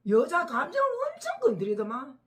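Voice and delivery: real indignant outburst. Real, not acted. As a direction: get worked up about the man's behaviour. Indignant retelling of TV drama.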